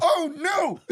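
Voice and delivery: laughing, high loud "oh no"; mock horror. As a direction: cry out in laughing dismay. Joking horror at the unmasking.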